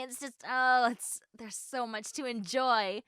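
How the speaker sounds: ordinary talk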